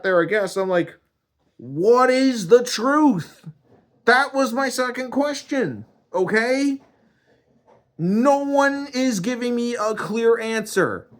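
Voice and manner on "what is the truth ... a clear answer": high, strained and loud pleading; exasperated demand. Acted. Man begging God for a clear answer, exasperated.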